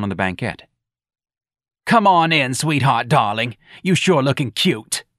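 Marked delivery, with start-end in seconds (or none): 1.9-5.0 higher, louder, slow welcoming neighbour; brassy New Orleans hostess. Acted. Santa welcoming Missus Riley inside, gushing. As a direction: gush over the guest like a Yat aunt.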